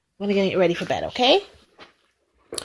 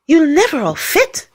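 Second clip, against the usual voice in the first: higher voice, a touch of chatter; chattering with cold; 7 semitones higher, 5 dB louder than usual. A narrator's reading. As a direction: protest as a chilly dog. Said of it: "chattered Harry".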